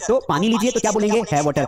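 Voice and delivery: ordinary talk, nothing marked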